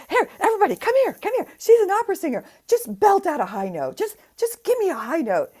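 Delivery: put-on high, loud drunk calling the room over; a tipsy guy shouting to everyone, mock drunk; drunk party guy. Drunk guest calling partygoers to hear the singer, high and loud. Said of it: "he's completely drunk".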